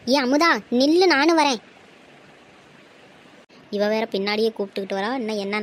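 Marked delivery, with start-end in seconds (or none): 0.1-1.6 loud, higher-pitched call after someone leaving. Acted. Calling after a friend to wait, loud call. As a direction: call out to make them wait.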